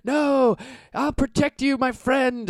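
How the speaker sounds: very high, loud protective shriek; desperate friend. Acted. Buddy shielding his friend from the Minotaur, shrieking high. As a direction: throw yourself over him screaming. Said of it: "he says".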